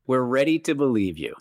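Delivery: bright, eager ad-read quote; Ghostbusters ad line. Retold or imitated. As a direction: deliver it like a cheesy movie ad. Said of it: "Ghostbusters commercial segment".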